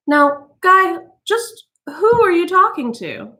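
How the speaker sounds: high, uneasy wife's voice; high wary wife; Mildred. A narrator's reading. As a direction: question him with forced brightness.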